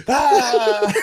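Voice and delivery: high burst of laughter; cracking up in class; laughing shriek. Retold or imitated. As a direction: lose it completely. Uncontrollable laughing fit.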